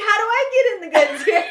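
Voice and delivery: high, fast and laughing; mock scheming. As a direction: wheedle it in a high, quick voice. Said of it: "I'm like".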